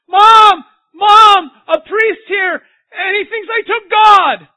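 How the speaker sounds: put-on a panicked boy shouting to his mom about the priest; panicked yell. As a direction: scream for mom as a terrified kid.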